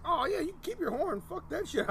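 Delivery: high, squeaky retold brush-off; mock disgusted refusal. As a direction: throw it back in a high put-on voice.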